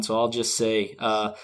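ordinary talk, nothing marked

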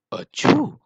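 very high, loud "achoo"; big mock sneeze. A narrator's reading. Wild Boar after a deep breath, huge high sneeze. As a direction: explode into a pretend sneeze. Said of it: "taking a deep breath".

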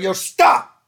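loud startled yell at a dog; loud yell at a charging dog; sharp yell. Retold or imitated. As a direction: stop the dog with your voice. Vic yelling at charging dog.

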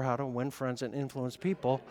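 ordinary talk

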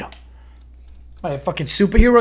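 usual speaking voice

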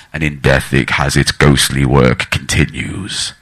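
very low, slow ominous intonation; spooky ghost-story voice. Pretend. Comic telling crowd of a killer poltergeist, mock spooky. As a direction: drag it out like a sinister horror narrator. Spooky line meant to scare audience.

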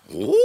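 very high, held-out whoa; long ecstatic whoop. Retold or imitated. Imitating the dancing man in church, long whoop. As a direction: wail it like a man dancing in the aisle. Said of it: "he'd go".

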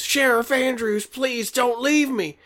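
high, loud mock scream for help; screamed plea. Dragged-away man begs the sheriff not to leave, hammed-up scream. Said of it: "as he screams".

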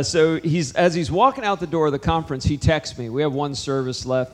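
normal talking voice

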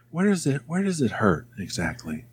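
soft, hushed mock concern; imitated whisper voice. Boss probing employee's anger, mock hushed whisper voice. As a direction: drop into a gentle near-whisper imitation. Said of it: "whisper voice".

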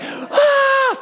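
squealing Ah of a dream come true; high girly scream. Retold or imitated. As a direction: let out a giddy high shriek. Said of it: "scream like a girl".

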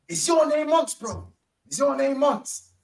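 high, exaggerated Birmingham accent; Birmingham fan's accent. Fan recognises the streamer in Birmingham, high mock Birmingham accent. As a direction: imitate a fan checking who you are, thick Birmingham accent. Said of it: "his accent was on ten".

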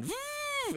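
high drill whine imitation; drill noise. Describing a movie villain's drill, high mock drill whine. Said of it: "he just starts going".

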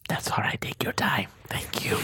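hushed nervous whisper; soft whispered thanks. Acted. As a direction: whisper it, shy and scared.

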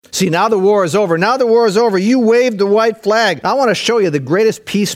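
high, fired-up sermon delivery; impassioned preacher in full flow; full-force preaching. Acted. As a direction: preach it urgently, piling phrase on phrase.

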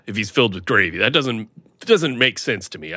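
usual speaking voice

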